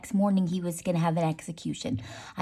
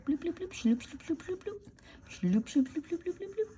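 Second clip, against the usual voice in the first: sound effects; 10 semitones higher, 6 dB quieter than usual. Pretend. Sound effects while drawing scallops.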